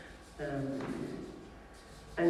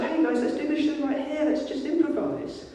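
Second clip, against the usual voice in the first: eager improviser; 10 semitones higher than usual. Retold or imitated. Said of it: "Some people are just like".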